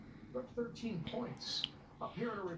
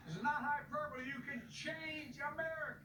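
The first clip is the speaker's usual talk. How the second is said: rally speech; 7 semitones higher than usual. Acted. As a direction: rouse the crowd to go vote. Impassioned rally speech to voters.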